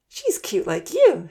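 put-on girl smiling at a friend. A narrator's reading. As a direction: say it with a sweet smile. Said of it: "Santa smiled".

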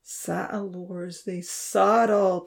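slow, heavy lament. A narrator's reading. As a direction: let each word sink with grief. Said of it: "Jax lamented".

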